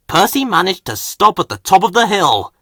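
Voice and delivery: ordinary delivery, nothing marked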